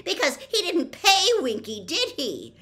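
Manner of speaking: very high, loud and strained; voice rising shrilly. A narrator's reading. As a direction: let your outrage peak in the pitch. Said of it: "her voice rising shrilly".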